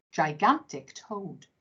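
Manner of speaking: usual speaking voice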